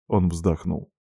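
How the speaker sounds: plain reading voice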